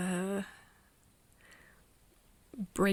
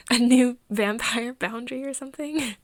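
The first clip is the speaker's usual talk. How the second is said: louder, laughing through the words; amused laughing; 3 semitones higher, 7 dB louder than usual. Acted. Asking about a new vampire boundary, amused and laughing. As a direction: let the laugh bubble into it.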